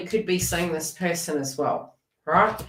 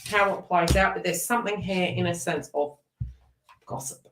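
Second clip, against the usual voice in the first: ordinary talk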